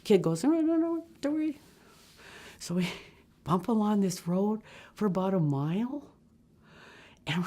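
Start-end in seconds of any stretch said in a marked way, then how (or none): 0.4-1.6 high light no no no; a boy brushing off their fear; high reassuring boy. Retold or imitated. Kid reassures nervous passengers.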